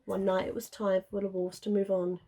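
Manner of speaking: plain reading voice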